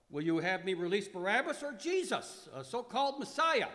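loud, high call to the crowd. Acted. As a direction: Pilate calls out over the crowd. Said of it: "and called out".

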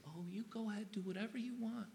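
high, sing-song mock indulgence; mock permissive. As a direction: parody a soft, anything-goes voice.

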